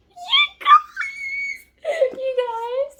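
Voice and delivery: very high, drawn-out emotional squeal; overwhelmed squealing. Real, not acted. Reader calls to her viewers over book news, tearful squeal. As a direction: squeal it as if about to cry with joy.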